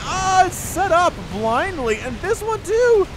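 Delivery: loud, high-pitched cries of alarm; alarmed commentary. Real, not acted. Caster watches units fly blindly into turrets, alarmed. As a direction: voice climbing to a yell, words stretched out.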